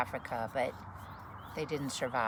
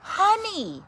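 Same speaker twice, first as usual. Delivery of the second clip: much higher, louder eager call; eager honey; 14 dB louder than usual. Acted. Bear waking at the buzzing bee, high eager call. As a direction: wake up craving honey.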